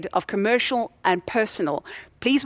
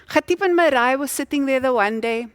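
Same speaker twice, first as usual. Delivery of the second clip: usual speaking voice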